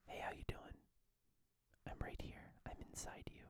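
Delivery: breathy whisper into the mic; creepy intimate whisper in the ear; right in your ear. Acted. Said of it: "I'm gonna talk right into your ear".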